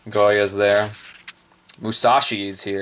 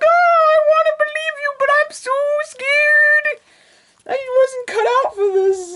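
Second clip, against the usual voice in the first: Naka scared; 4 dB louder than usual. Acted. Naka too scared to fight, very high whimper. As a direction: whimper like a frightened idol. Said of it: "And Naka's like".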